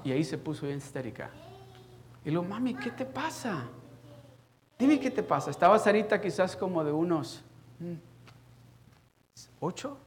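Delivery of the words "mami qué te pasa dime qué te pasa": insistent questioning. Retold or imitated. Father pressing his crying daughter.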